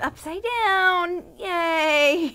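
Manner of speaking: loud, slow drawn-out cheer; excited cheer. Real, not acted. Aunt praising the toddler upside down, loud slow cheer. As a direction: stretch it out, cheering loudly.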